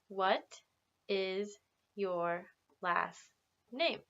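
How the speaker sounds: very slow, clear teacher modelling; slow model question. Shown as a demonstration. Teacher modelling a question slowly for students. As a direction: lay out each word for the class. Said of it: "say that with me".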